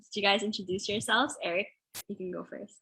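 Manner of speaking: ordinary talk, nothing marked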